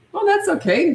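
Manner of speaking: high, singsong cheery reassurance; breezily cheerful, no consoling. Shown as a demonstration. Parent to crying three-year-old after tantrum, cheery. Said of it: "Just still have that cheery tone".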